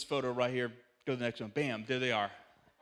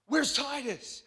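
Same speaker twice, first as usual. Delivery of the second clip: alarmed question; 8 semitones higher, 4 dB louder, slower than usual. Retold or imitated. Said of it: "my wife gives me this look".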